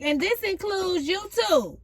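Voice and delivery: high, sassy pointed callout; high-pitched sassy dismissal. Pretend. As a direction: call them out with attitude. Sassy declaration.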